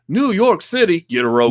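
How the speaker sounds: put-on Texas accent. Quoting the salsa commercial in a mock Texas accent. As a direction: ham up the old salsa ad cowboy. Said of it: "replicate a Texas accent".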